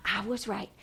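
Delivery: very soft, private thinking aloud; whispered thinking voice. Shown as a demonstration. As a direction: murmur your thoughts as an aside. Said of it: "I lean over here and I whisper".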